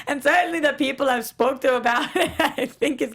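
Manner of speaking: put-on quoting the actor in a giggly Scottish accent; a cartoonish Scottish accent that keeps breaking into laughs; Scottish impression. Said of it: "that's my Scottish impression".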